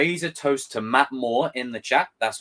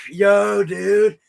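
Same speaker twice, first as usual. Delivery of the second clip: higher, louder surfer bro; laid-back dude voice pitched up; 9 semitones higher, 4 dB louder, much slower than usual. Retold or imitated. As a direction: play the goofy surfer writing scripts. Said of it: "that surfer dude from the trailer going".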